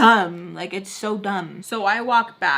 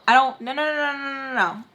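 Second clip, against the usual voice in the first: ordinary talk, nothing marked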